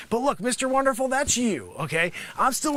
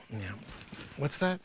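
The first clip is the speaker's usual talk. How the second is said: usual speaking voice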